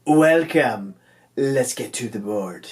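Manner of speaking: half-hearted pirate, slightly put on; lightly voiced pirate greeting. Host opening a hockey game review, playful half-hearted pirate voice. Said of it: "I think that was my pirate voice".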